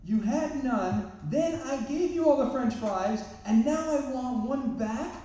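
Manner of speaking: high, slow, exaggerated exasperation; mock exasperated lecture. Father lecturing daughter over one fry, hammed-up exasperation. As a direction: lay it on thick like a wounded dad.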